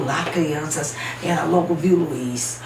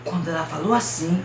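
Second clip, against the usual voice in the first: plain reading voice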